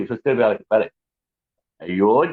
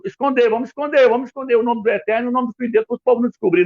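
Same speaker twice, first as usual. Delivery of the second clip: tradutores conspirando, imitados; 6 semitones higher, faster than usual. Retold or imitated. Tradutores imitados escondendo o nome.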